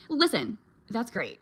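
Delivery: normal talking voice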